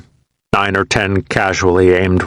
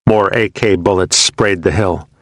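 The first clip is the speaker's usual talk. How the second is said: ordinary delivery, nothing marked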